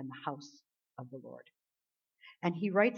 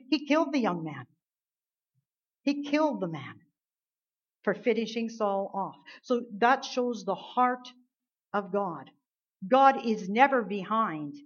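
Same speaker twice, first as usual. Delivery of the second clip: fervent preaching; 3 semitones higher, 5 dB louder than usual. Acted. Preacher to congregation, fervent full-force preaching. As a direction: drive the message home to the congregation.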